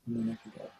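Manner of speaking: breathed, voiceless; silent breath demo. Shown as a demonstration. Teacher shows quietly how breath is kept. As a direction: let it out on breath alone, almost silent. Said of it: "So I could just gently inhale".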